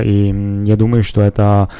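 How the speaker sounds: usual speaking voice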